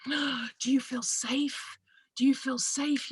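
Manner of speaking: hushed, breathy-free whisper, slow and anxious; worried whisper. Retold or imitated. UK radio callers asking her in a worried whisper. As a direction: whisper it like a frightened relative. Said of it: "people say to me".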